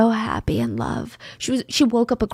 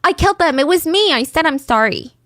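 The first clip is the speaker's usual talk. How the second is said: high, sudden outburst easing at the end; blurted confession; 4 semitones higher than usual. Retold or imitated. Detainee suddenly confessing to investigators, blurted out. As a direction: blurt it out as an exasperated admission. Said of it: "she blurts out".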